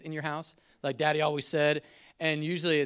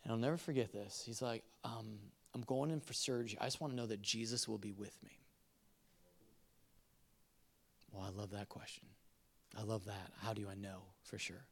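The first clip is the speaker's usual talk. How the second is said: gentle reassurance; 6 semitones lower, 14 dB quieter than usual. Retold or imitated. Tender recounting of hospital call.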